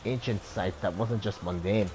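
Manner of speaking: put-on Dublin accent. Ex-ranger tells a stranger her story, Dublin accent. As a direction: tell your past in a Dublin lilt. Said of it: "Born and raised in Dublin".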